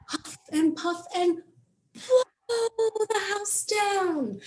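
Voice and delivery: a high, slow, hammed-up huffing wolf; big bad wolf. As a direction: play the wolf blowing the house down. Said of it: "and you get to".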